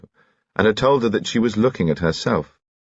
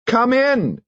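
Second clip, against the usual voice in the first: called out. A narrator's reading. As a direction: call out brightly to the knock. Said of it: "cried a voice".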